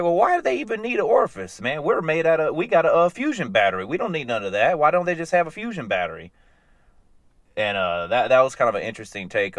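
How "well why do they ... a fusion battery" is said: higher, quick scoffing robot voice; mock robot scoff. Robot mocking humans, quick high scoff. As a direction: play the baffled robot, fast and high.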